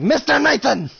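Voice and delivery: high, loud put-on summons; silly calling voice. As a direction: call the name out in a playful silly voice. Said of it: "it's fun to call Mister Nathan that in that voice".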